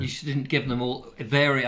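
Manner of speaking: ordinary talk, nothing marked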